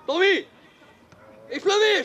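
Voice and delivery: loud, high shout to a player; urgent call. Real, not acted. Coach urging Tomi to shoot, shouting. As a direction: call out sharply across the pitch.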